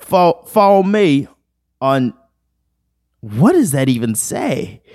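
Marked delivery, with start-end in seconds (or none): 0.0-2.1 put-on high, loud-ish halting British accent; mock British accent. Sounding out a British meme, mock British accent. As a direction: stumble through it in a hammed-up British accent. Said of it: "British people be like".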